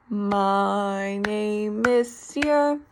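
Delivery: slow, drawn-out "My name is Sierra" as the client's attempt; client's slow repeat. Shown as a demonstration. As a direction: repeat it back slowly, effortfully. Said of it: "the client would then try to be like".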